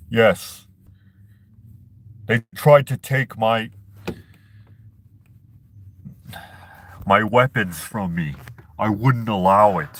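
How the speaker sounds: rough impression of a wrestler turned governor; half-manage a tough celebrity politician's voice; Jesse Ventura. Vlogger showing off a governor impression, roughly done celebrity voice. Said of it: "That's Jesse Ventura. I kinda, I can kinda do it".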